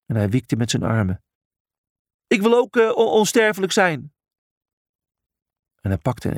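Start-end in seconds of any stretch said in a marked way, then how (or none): 2.3-4.1 hoge, gretige hakkelstem; hakkelende Brutus. A narrator's reading. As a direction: roep het hoog, struikelend over je woorden. Brutus roept stotterend om aandacht. (hoog en hakkelend geroepen)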